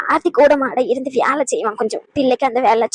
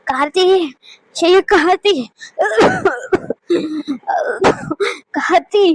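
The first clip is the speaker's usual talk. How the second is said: raised, higher call to someone out of sight; summoning someone from another room; 5 semitones higher than usual. Acted. Calling out for Karthi.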